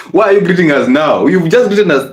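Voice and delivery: laughing through the words; amused laugh as you ask. Real, not acted. Mocking the neighbor who greets too often, laughing.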